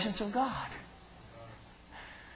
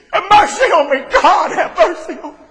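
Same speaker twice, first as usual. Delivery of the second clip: high, loud cry for mercy; preacher crying out his own prayer for mercy; crying plea; 13 semitones higher, 15 dB louder than usual. Acted. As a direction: plead with God through tears. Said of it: "all I can pray".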